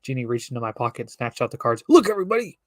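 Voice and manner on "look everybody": loud, high call to the crowd; cried out. Acted. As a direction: shout to get everyone looking. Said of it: "She cried".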